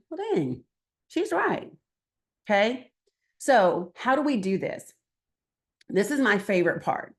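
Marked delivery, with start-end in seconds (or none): 0.1-4.9 higher, grudging mock agreement; won-over listener. Skeptical voter admitting the speaker has a point, in grudging mock agreement. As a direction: play the listener who gives in. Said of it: "They just hear me going".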